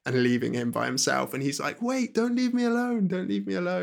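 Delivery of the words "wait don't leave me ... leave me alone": pleading. Retold or imitated. Simon begs as she disconnects.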